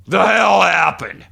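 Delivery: loud, high, slow anguished wail of a phrase; crying outburst. Retold or imitated. Imitating Bret cracking on camera, loud slow wail. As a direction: howl it like a man cracking. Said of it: "then he just cries and goes".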